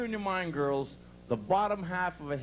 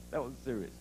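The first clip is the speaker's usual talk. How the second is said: normal talking voice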